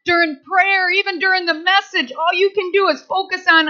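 ordinary talk

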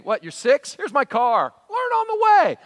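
breezy parent, building to a high shout; mock cavalier. Preacher playing a reckless parent handing a child the car, mock cavalier. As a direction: toss it off loudly, ending way up high.

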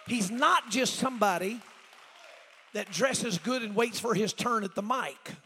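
loud, hard-driven praise from the pulpit; full-force preaching. Real, not acted. Preacher praising the hard-working bishop to his church, full-force preaching. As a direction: drive each phrase out to the crowd.

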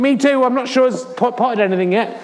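normal talking voice

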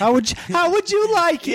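put-on high kvetching Yiddish accent voice.

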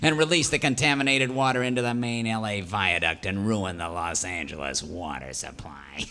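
ordinary talk

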